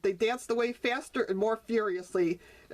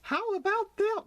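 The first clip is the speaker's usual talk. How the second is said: high surprised exclaim; pleasantly amazed; 11 semitones higher than usual. Acted. Mother frog surprised.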